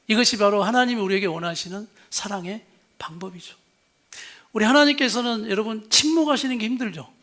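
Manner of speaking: ordinary delivery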